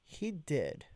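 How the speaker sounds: ordinary delivery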